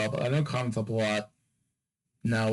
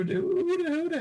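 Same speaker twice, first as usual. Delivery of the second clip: squeaky high voice. Real, not acted. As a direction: voice cracks way up high. Voice jumps way up.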